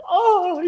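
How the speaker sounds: high, loud mock moan of pleasure; mock ecstatic moan. Imitating woman thrilled by whispered French, ecstatic moan. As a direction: imitate her swooning "oh". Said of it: "getting off on it".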